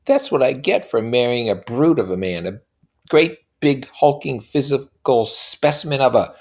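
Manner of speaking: mock her husband lightly; teasing Daisy. A narrator's reading.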